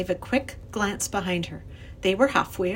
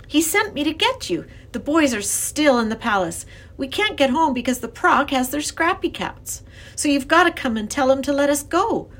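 put-on higher, louder girl character; urgent young girl; 7 semitones higher, 5 dB louder than usual. A narrator's reading. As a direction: press the professor with the news. Lindy's urgent speech.

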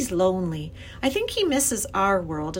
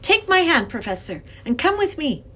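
put-on firm young girl, high and emphatic; 8 semitones higher, 5 dB louder than usual. A narrator's reading. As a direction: command the professor firmly. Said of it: "she had to be very firm".

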